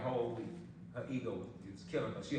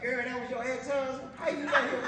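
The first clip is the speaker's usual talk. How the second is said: excited gossiping friends; 10 semitones higher, 5 dB louder than usual. Retold or imitated. Her friends voiced.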